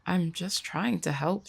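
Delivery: ordinary delivery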